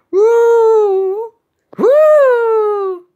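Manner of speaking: loud, very high drawn-out woo; high whoop. Acted. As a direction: let out a piercing cartoon whoop. Wordless high cry for shark.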